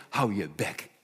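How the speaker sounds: bot afkappend, laag begonnen; geïrriteerde meute. Acted. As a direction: snauw hem af als de meute. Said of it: "De meute zegt". (de meute snauwt de blinde toe)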